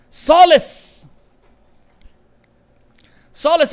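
loud, high burst of triumph; sudden exultant exclamation; triumphant shout. Real, not acted. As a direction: call it out like a found answer. Triumphant at finding the word.

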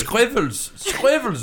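high-pitched "Squivels" with a V replacing the R; German mispronunciation. Retold or imitated. Comic imitating Germans on YouTube, high mispronounced "Squivels" with a V. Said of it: "they YouTube it and they can't".

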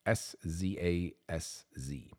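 ordinary talk, nothing marked

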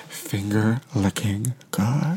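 hushed, lingering ad slogan; savoured slogan. Acted. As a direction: draw out each word of the tagline. Mock slogan as the movie's moral.

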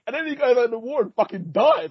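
high-pitched, incredulous storytelling; worked-up retelling. Retold or imitated. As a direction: recount it in high-pitched disbelief. Retelling a shocking death, worked up.

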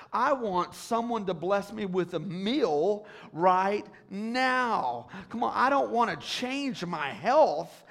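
building to a loud pleading cry; preaching at full force. Acted. Preacher voices a demanding believer.

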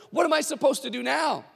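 high, exasperated complaint; frustrated follower. Retold or imitated. Crowd member complaining to Forrest, exasperated. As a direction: vent like a let-down fan. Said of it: "frustration and disappointment".

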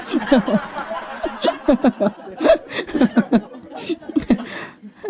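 long bright burst of laughter at her own joke; laughing at the punchline; hearty laugh. Real, not acted.

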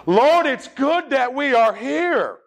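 high, slow Southern drawl; Southern accent. Shown as a demonstration. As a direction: stretch it out with lots of Southern accent. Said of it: "get a lot of Southern accent into it".

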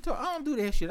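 ordinary talk, nothing marked

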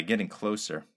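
plain reading voice

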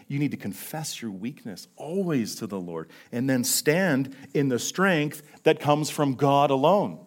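usual speaking voice

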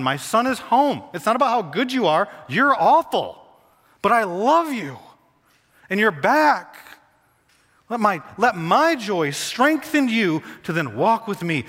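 high, loud, impassioned welcome home; preacher voicing a fervent father, slow on key words; prodigal's father. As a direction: cry it out as a father overjoyed at his son's return. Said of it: "the prodigal father saying to his son".

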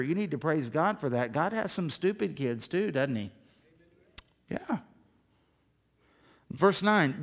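normal talking voice

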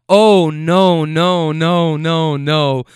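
loud string of protests, easing off; mock dismay. Rider objecting amid her friends' cheering, mock dismay. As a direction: imitate someone wailing in protest.

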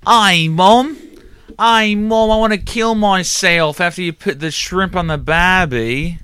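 high, loud mock Australian wail; mock Australian crying. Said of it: "say it with your Australian accent".

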